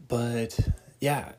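ordinary delivery

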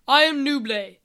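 loud, commanding introduction; army leader. Acted. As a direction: announce yourself like a commander.

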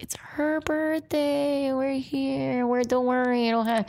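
high, slow pleading to be let in; begging the bouncer friend, stretched and high. Retold or imitated. Friend begging him at the door, high and slow.

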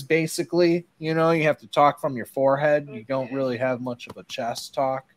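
ordinary talk

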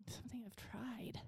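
unvoiced whisper. Real, not acted. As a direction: breathe it in a whisper. Whispered aside.

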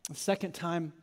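lightly voiced hurt confession; broken Peter. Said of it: "looks at him broken and says".

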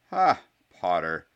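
slow, loud scoff. A narrator's reading. Draco answers Harry's terms, loudly scoffing. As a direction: scoff for all to hear. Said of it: "said Draco loudly".

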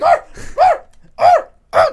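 loud, high-pitched barks; sharp, repeated mock dog barks; imitated dog barking. Comic imitating the kennel dog barking at the wall, loud barking. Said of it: "like, barking".

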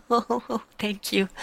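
high, giggly mock bashful thanks; coy thank you. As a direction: play it coy and flattered.

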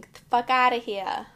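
loud, punchy catchphrase. Shown as a demonstration. Telling the cousins off in a booming catchphrase. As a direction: belt it out for the cousins. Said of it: "And I say this saying".

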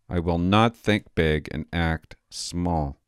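slow, every word firm, leaning on not; defiant refusal. Real, not acted. Refusing the paper's advice, slow defiant stress. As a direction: lay down the law, deliberately.

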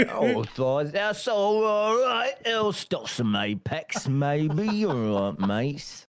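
slow, put-on Cockney geezer accent. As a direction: drawl it out like a Cockney gangster from a Guy Ritchie film. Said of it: "turn into a Cockney Guy Ritchie character".

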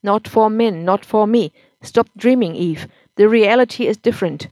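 husband loudly insisting to his wife; insistent loud husband. A narrator's reading. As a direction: drive the point in hard.

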